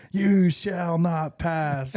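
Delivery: slow, high, booming wizard proclamation; Gandalf impression. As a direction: boom it out like a wizard. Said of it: "from, like, Lord of the Rings".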